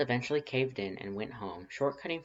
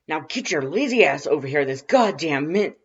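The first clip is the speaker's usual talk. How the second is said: higher, much louder barked order; irritated shout; 6 semitones higher, 13 dB louder than usual. A narrator's reading. Irritated brother ordering Lust over on the phone, loud shout. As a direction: yell at him to hurry, cut off. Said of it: "more irritated".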